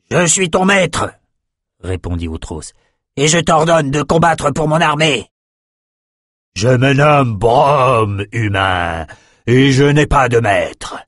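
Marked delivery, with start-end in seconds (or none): 0.1-1.1 haut et lent, il affirme sa domination; ton dominateur. Acted. Outros réplique au dragon. 3.2-5.3 plus haut, il commande sèchement; ordre autoritaire. Acted. Outros ordonne au dragon. 6.6-11.0 lent et solennel, un dragon fier; voix tonitruante de dragon. Acted. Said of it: "Sa voix tonitruante".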